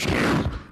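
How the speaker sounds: short loud hissing skid; mouth-made screech to a halt; braking car noise. Acted. Husband pulling into maternity ward, skidding car sound.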